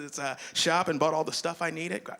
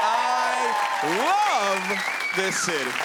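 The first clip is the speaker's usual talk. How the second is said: louder, slow declaration; gushing shout; 5 semitones higher, 5 dB louder, much slower than usual. Real, not acted. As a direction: lay it on thick to the crowd. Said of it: "is my nose brown".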